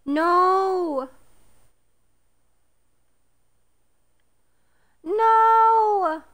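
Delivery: high, loud cry of dismay. Real, not acted. Owner crying out that the fish photo is blurry. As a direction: wail at the ruined shot.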